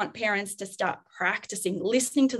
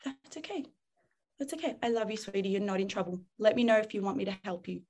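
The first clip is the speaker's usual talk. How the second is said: much softer, tender reassurance; soothing parent; 6 dB quieter, faster than usual. Shown as a demonstration. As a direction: gently reassure a storming child. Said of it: "parent soothes child".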